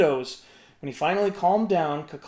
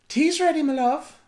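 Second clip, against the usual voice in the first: higher tender call; loving wife; 9 semitones higher, 3 dB louder than usual. A narrator's reading. Una calling Finn to tea, tenderly.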